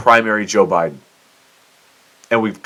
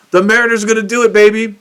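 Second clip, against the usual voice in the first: excited fan; 12 semitones higher, 5 dB louder, faster than usual. Pretend. Imagined celebration as a fan.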